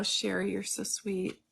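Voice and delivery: normal talking voice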